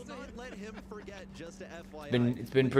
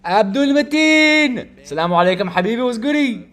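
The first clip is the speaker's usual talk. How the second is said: shouted greeting; 12 semitones higher, 10 dB louder than usual. Real, not acted. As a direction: belt out an excited hail to a friend. Hailing an arriving friend.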